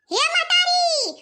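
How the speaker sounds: slow, high, loud call; drawn-out call. Acted. Daughter-in-law calls her mother-in-law out of the house, slow loud call. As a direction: stretch it out to reach the mother.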